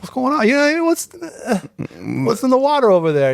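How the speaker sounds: high, eager mimicry of a fan; impressed stranger. A stranger quizzing a Toronto native, mimicked high and eager. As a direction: imitate someone perking up, pitch way up. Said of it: "you see the posture change".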